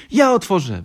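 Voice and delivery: high, loud eager shout; exclaiming. A narrator's reading. Little fox rushing to the doorbell, eager high shout. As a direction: burst out in a child's high voice. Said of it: "wykrzyknął mały Lisek".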